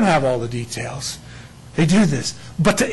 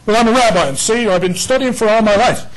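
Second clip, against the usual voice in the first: a hint of a proud rabbi, higher and louder; mocking a rabbi; 5 semitones higher, 4 dB louder than usual. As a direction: lightly voice a smug rabbi's objection. Mock rabbi objecting to preacher.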